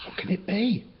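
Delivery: high, anxious little question; worried question. Acted. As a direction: ask it high and nervous. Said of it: "she asked".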